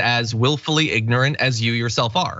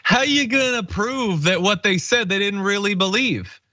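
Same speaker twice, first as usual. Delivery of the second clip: high-pitched mocking question; mock Jesse Waters; 7 semitones higher than usual. Co-host quoting a pundit's stupid question, in a high mocking imitation. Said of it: "another one of Jesse Waters', uh, stupid questions".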